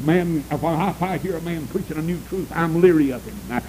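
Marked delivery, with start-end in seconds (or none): none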